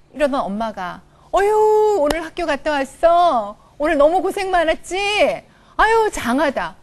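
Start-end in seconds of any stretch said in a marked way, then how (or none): 1.3-6.8 tender praise. Acted. Changed mother praising child.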